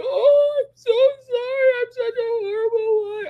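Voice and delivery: hammed-up tearful apology in a squeaky falsetto; imitating crying wife. Husband mimics his wife crying over a forgotten errand, high mock sob. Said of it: "she just starts crying and go".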